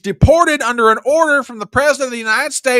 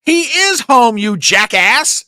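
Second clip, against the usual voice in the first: louder, higher, slow, leaning hard on IS; angry rebuttal; 6 semitones higher, 6 dB louder, slower than usual. Real, not acted. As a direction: insist he IS home, punching the word "is". Furious rebuttal to senator's clip.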